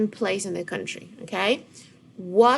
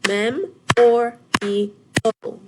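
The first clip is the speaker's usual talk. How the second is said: Mem or E O chanted loud and slow, one clap each; clapped syllables; 2 semitones higher, 9 dB louder, much slower than usual. Shown as a demonstration. Teacher clapping memorial with the class, loud. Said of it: "Get your hands ready".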